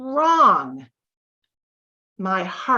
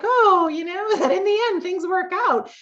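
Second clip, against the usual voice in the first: sugary high mock-sweet voice, with a laugh; mock pretty; 10 semitones higher than usual. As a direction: parody a saccharine, pretty-sounding lesson, pitched high. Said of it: "doesn't have to sound all pretty and good".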